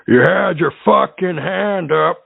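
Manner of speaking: mock a fed-up radio host scolding a caller; hammed-up angry host, drawn out; radio host impression. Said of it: "in the words of a great radio show host".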